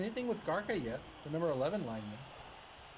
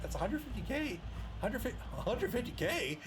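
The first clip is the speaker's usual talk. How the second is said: surprised; 6 semitones higher than usual. Real, not acted. Surprised at unused bribe money.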